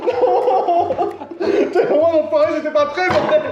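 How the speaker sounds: high shrieks through laughter; panicked laughing yelps. Real, not acted. Getting shocked by the collar.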